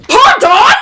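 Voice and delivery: high, loud shocked pardon; outraged pardon. Real, not acted. Reacting to the book hero, a shocked loud pardon. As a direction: squeal it in disbelief.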